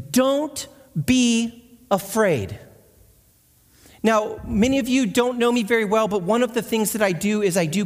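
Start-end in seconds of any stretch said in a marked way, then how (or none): 0.1-2.6 gentle reassurance. Acted. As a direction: stretch it out to calm a frightened girl. Angel reassures Mary, quoted.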